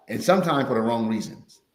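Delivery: normal talking voice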